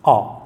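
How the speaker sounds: अ as a low, drawn open "aw"; low-pitched, rounded अ; vowel sound demo. Shown as a demonstration. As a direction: model the isolated अ sound as "aw". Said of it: "ये अ sound है".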